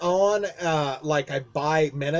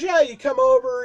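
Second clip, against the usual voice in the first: imitating boss, higher and louder; 9 semitones higher, 6 dB louder than usual. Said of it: "he goes".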